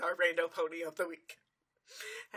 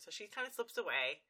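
ordinary talk

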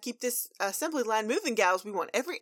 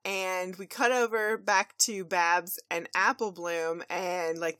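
ordinary talk, nothing marked

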